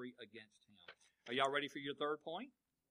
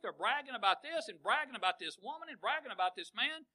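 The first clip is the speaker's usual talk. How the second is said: high, loud preacher's cry; full-force sermon, high and loud; fiery preaching; 9 semitones higher, 12 dB louder than usual. Acted. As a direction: rail at the congregation from the pulpit.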